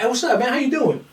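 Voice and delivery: high-pitched, over-friendly hello; cheery greeting. Retold or imitated. Approaching the sore loser at the gym, high cheery greeting. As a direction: greet him high and innocent, hamming it up.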